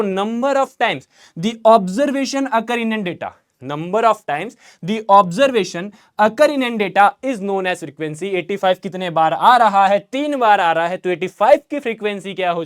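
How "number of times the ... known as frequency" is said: slow, word-by-word dictation of the definition. Shown as a demonstration. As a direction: dictate it slowly for notes. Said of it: "teacher dictating definition". (धीमी आवाज़ में notes लिखवाते हुए)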